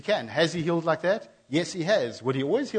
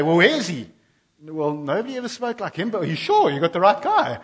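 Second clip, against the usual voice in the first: louder, higher, indignant; indignant demand; 4 semitones higher, 7 dB louder than usual. Retold or imitated. Pharisees scolding the guards, loud indignant demand. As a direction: demand where the prisoner is.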